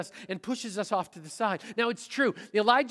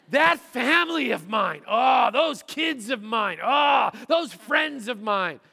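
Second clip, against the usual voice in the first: loud, higher, slow exasperated shout; mock angry rant; 4 semitones higher, 7 dB louder, slower than usual. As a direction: shout each complaint louder in mock frustration. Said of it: "That's contempt".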